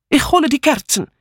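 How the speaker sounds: high-pitched maid's call; eager calling out. A narrator's reading. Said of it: "rief Mitzi". (mit hoher Stimme gerufen)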